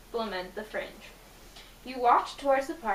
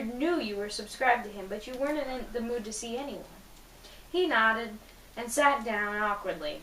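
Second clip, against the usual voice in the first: usual speaking voice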